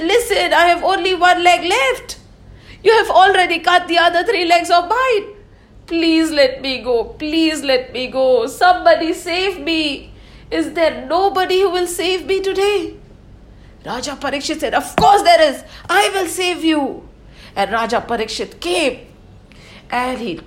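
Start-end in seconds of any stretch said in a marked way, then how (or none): 0.0-12.9 high, louder pleading cry for help; desperate bull. Acted. The bull begging the king for help, high and desperate. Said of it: "But the bull said". 14.7-17.1 a hint of a bold king, higher and louder; heroic king. King Parikshit promising the bull rescue, lightly voiced and bold.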